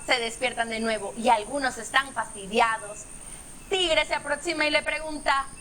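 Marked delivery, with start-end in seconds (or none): none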